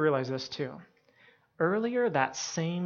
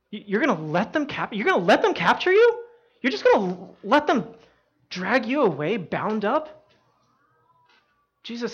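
high-pitched, stammering disbelief, swelling then easing; bewildered protest; 6 semitones higher, 5 dB louder than usual. Retold or imitated. Peter watching Jesus let himself be captured, bewildered. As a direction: act out pleading for an explanation.

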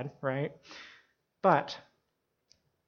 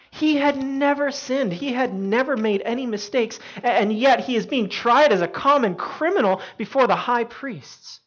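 high, raised voice of outrage; impassioned preaching; 8 semitones higher, 7 dB louder than usual. Real, not acted. Preacher insisting Jesus was innocent, impassioned. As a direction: drive the injustice home forcefully. Preacher stresses injustice of trial.

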